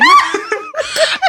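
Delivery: very high burst of laughter; shrieking laugh. Real, not acted. Friend cornered in a teasing argument, shrieking laugh. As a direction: let a squealing laugh fly.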